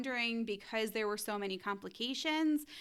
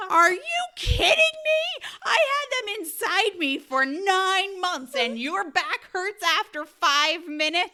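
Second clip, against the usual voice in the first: loud, high, incredulous retold rant; outraged wife; 9 semitones higher, 9 dB louder than usual. Wife to husband complaining of back pain, outraged. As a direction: blast him with mock outrage, stretching the key words.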